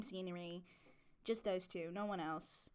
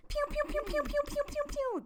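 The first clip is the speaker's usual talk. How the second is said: high, loud laser blaster noises; laser pew sounds; 5 dB louder than usual. Pretend. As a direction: mouth sound effects like a toy ray gun. Host making laser sound effects.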